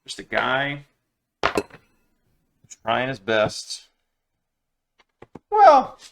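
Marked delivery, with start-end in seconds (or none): 5.5-6.0 sudden exclamation. Real, not acted. Self-correcting exclamation.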